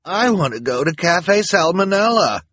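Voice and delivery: high, loud, demanding snarl; loud, petulant demand. A narrator's reading. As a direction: snarl it like a thwarted villainess. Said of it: "Esme snarled".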